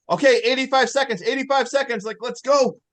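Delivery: high, urgent mock countdown. Imitating kids in the background timing her, urgent. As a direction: raise the pitch and hurry them along.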